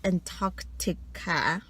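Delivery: "Antarctica" drawn out at half speed; careful slow pronunciation. Real, not acted. Quiz player tackling a tricky word, "Antarctica" said slowly. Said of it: "I have to say this slowly".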